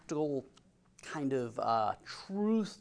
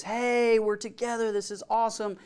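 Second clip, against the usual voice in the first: high-pitched happy greeting; acted delight; 7 semitones higher, 3 dB louder than usual. Relatives meeting for the first time, acted delight. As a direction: lift the pitch as if thrilled to reunite.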